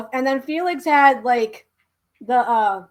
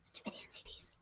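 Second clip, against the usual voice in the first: breathy voiceless whisper; 30 dB quieter, faster than usual. Shown as a demonstration. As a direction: whisper it as an aside. Repeats line, then whispers it.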